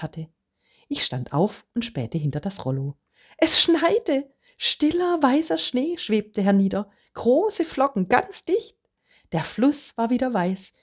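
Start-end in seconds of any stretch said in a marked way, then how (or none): 3.4-10.7 much higher, thrilled and amazed; delighted wonder. A narrator's reading. Das Kind sieht am Weihnachtsmorgen Schnee, ganz begeistert. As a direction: gasp it out like a child seeing snow. Child sees snow at Christmas.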